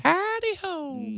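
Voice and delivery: very high, stretched-out cartoon greeting; Mister Hanky. Co-host teasing his partner with a high cartoon greeting. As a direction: drag it out like a squeaky cartoon character. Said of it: "Sounded like a Southern Mister Hanky".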